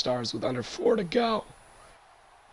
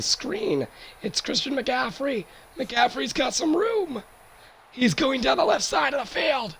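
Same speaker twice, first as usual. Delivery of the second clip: high, rising play-by-play excitement; excited call; 12 semitones higher than usual. Real, not acted. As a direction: call the big run as it unfolds. Long run play call.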